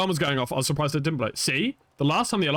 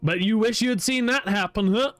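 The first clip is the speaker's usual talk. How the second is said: put-on hillbilly accent; 6 semitones higher than usual. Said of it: "Apparently he's a hillbilly now".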